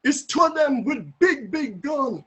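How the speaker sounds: high, urgent pleading in swooping tones; frightened warning. Retold or imitated. Frightened neighbour warning Mister B away from the gunmen, pleading. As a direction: warn him off, pitched well up. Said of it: "she says".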